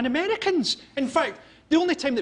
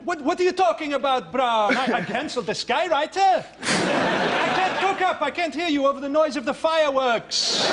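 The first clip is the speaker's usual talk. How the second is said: mock Gaddafi; 6 semitones higher than usual. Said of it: "frowns on the phone to, to Gaddafi".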